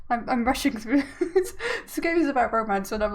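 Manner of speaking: amused, laughing at oneself; laughing while talking. Real, not acted. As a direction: let a laugh break into the words. Breaks character, laughing at herself.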